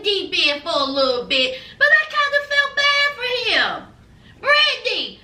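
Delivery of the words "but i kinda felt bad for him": mock crying. Host mock-crying over Yazan.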